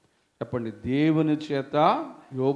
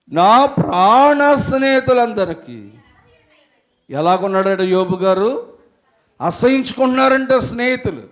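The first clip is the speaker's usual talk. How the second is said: loud, high fervent sermon voice; full-force preaching; 8 semitones higher, 11 dB louder than usual. Acted. Preacher retelling Job's friends to congregation, fervent. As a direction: preach it out with fire.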